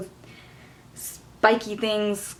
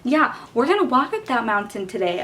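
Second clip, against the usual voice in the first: mocking teacher voice; 7 semitones higher than usual. Teacher announcing the mountain hike to tired kids, mock cheerful. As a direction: ham up the overly cheerful teacher. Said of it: "the next day he's like".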